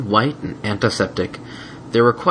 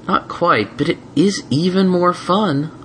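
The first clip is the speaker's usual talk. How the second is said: sweet, teasing and slow; gentle mare voice; 9 semitones higher, slower than usual. Acted. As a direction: tease kindly. Twilight voiced.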